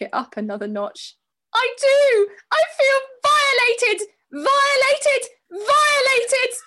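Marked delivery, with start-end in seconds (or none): 1.5-6.5 high, loud, tearful repeated shout; escalating hysterical shout at the staff; dramatic shouting. Retold or imitated. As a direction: scream it out, half crying. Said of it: "I shouted".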